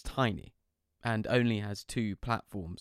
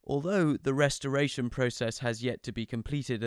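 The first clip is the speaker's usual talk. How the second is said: plain reading voice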